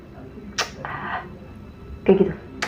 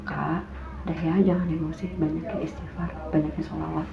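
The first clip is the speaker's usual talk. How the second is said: soft, much quieter gentle counsel; calm her down like a caring aunt; calm soothing advice; 5 semitones lower, 10 dB quieter than usual. Retold or imitated. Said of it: "Tante gue ngomong gitu".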